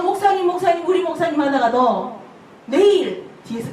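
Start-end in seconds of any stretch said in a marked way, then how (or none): 0.0-1.4 mock fawning. Congregant fawning over pastor.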